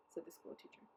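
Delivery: usual speaking voice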